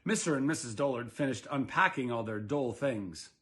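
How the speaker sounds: ordinary delivery, nothing marked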